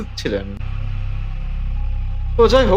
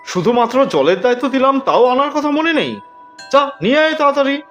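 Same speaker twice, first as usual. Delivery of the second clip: higher-pitched, irritated telling-off; angry scolding; 5 semitones higher than usual. A narrator's reading. Friends scolding Shyamal for forgetting the water, angrily. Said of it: "সবাই তো রেগে একদম আগুন হয়ে গেলেন".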